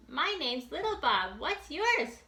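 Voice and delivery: high small bunny voice; little bunny. Acted. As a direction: play a tiny eager bunny.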